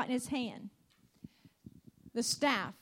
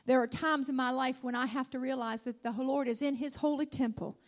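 ordinary talk

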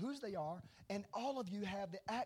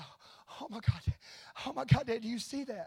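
imitating an awestruck little boy, building louder and higher; overcome young son; 6 semitones higher, 8 dB louder than usual. Young son to his dad on seeing a vision, overcome child voice. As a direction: gasp it out like an overwhelmed child. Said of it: "he just cries so heavily".

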